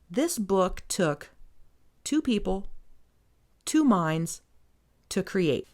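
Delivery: "this book took" drawn out slowly, then a pause before "two people" and "two minds"; halting emphasis, "two people", "two minds", "to create" each set apart; dramatic pauses. Real, not acted. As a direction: break after "took", "people" and "minds" for weight. Mock-solemn point about authorship.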